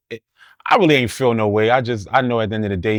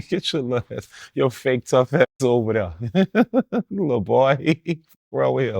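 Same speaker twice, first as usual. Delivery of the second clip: mocking through chuckles; laughing taunt; 4 semitones higher than usual. Real, not acted. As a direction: crack up while putting him down. Mocks the caller while laughing.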